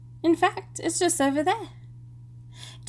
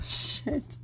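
muttered under the breath; whispered curse; 3 semitones higher, 10 dB quieter than usual. Real, not acted. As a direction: whisper it to yourself. Whispered curse after flub.

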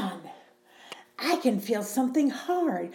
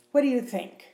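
ordinary talk

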